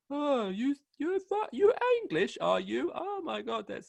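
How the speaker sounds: high, gushing imitation of an American; mock American fan. Guest imitating people who notice he's English, mock American gushing. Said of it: "the people comes up to me".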